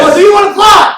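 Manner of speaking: very high, very loud hype shout; full rallying yell. Acted. Coach whipping penguins into a frenzy, full rallying yell. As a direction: push the crowd to its loudest.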